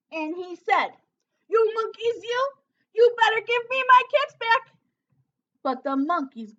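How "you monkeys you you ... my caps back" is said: loud, high furious yell; angry shout. A narrator's reading. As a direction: stamp and yell for the caps. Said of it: "Now he felt quite angry".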